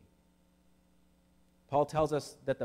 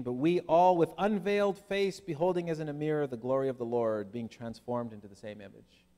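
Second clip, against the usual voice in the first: a touch of louder preacher's reading, easing off at the end; preaching scripture; 4 dB louder than usual. Acted. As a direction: read the verse out to the congregation with weight. Reads scripture at full force.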